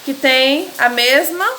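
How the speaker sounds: ordinary delivery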